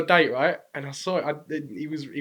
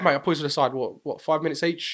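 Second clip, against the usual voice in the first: ordinary delivery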